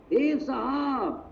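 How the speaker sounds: high, drawn-out, delighted hail; delighted greeting. Retold or imitated. Veteran coach happily recognizing a young entrepreneur, slow excited greeting. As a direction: stretch the greeting out with glad recognition.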